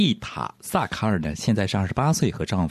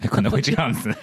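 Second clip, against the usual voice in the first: ordinary talk, nothing marked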